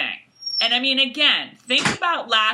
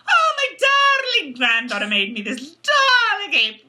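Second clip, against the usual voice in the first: hammed-up gushing grandmother, high start; doting Missus Fitz; 7 semitones higher, 3 dB louder than usual. Recapper plays a doting grandmother in the kitchen, hammed-up gushing. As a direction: gush theatrically over a grandchild's gift. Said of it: "Missus Fitz is like".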